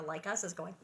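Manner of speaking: usual speaking voice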